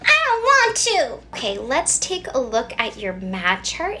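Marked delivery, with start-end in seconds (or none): 0.0-1.2 high, loud refusal; defiant child. Acted. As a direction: refuse like a stubborn kid.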